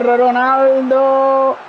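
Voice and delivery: high, stretched-out player name; excited drawn-out call. Real, not acted. Attack building, name called out. (nome allungato e acuto, entusiasta)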